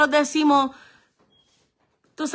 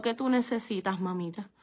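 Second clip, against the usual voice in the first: softer, gentle and tender; humble and calm; 6 semitones lower, 9 dB quieter than usual. Retold or imitated. Mother answering the woman who insulted her, softly and kindly. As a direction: speak to her gently, with no grudge. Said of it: "Mi mamá con humildad, tranquila".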